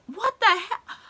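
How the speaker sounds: high-pitched stunned "what the hell"; shocked disbelief. Retold or imitated. Fan reacting to hero's death, high shocked disbelief. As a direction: blurt it out, thrown by the twist. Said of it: "I'm thinking".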